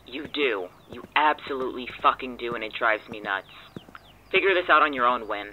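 loud furious rant; shouting, fed up; angry outburst. Acted. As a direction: let the anger boil over and hang up. Quarrel about being ignored.

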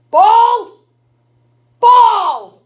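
high, full shout; calling out. Acted. Granny calls for someone somewhere here, high loud shout. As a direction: holler for someone out of sight.

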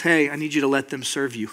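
low, soft correction; gentle Jesus. Acted. Jesus telling the eager helper to be served, gentle. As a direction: step in quietly and kindly.

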